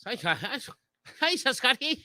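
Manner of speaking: playful greeting. Acted. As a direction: greet a Scottish girl in a silly high voice. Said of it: "a nice Scottish girl".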